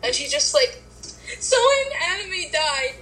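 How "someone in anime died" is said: very high mock-tearful wail; mock crying. Mimicking Michaela crying on the phone, high mock sob. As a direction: imitate a friend blubbering over something trivial. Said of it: "you were crying, obviously".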